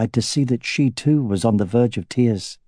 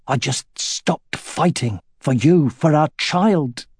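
choking on the words; 5 semitones higher, slower than usual. A narrator's reading. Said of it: "He choked on the words".

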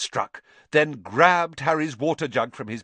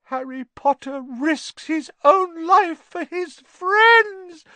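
slow, very high elf moan in miserable ecstasy; Dobby moaning; 6 dB louder, slower than usual. A narrator's reading. Elf marvels at Harry's loyalty, moaning in miserable ecstasy. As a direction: wail it slowly in loud, squeaky adoration. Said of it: "moaned Dobby in a kind of miserable ecstasy".

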